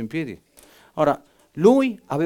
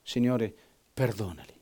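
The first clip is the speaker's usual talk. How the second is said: whispered, fading last words; 6 semitones lower, 8 dB quieter than usual. Retold or imitated. Preacher whispering dying Stephen's prayer.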